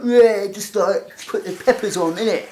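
imitating mum's slangy London talk, slow; lightly mock mum's everyday innit voice; mock casual London speech. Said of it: "She's normally a bit like".

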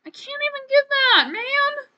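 very high, loud complaint; loud, squeaky protest at the game; frustrated whine. Real, not acted. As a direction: wail it in high-pitched frustration. Frustrated by unreachable item.

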